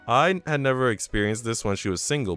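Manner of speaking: ordinary delivery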